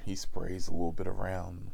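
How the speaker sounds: ordinary talk, nothing marked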